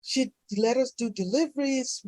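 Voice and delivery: ordinary delivery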